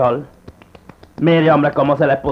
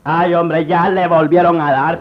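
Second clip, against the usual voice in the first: normal talking voice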